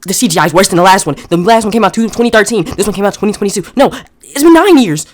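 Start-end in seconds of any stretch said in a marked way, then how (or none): 3.7-5.1 louder, higher and drawn out; slow, loud protest in disbelief; exasperated outburst. Real, not acted. Reviewer ranting at the makers of a bad sequel, exasperated outburst.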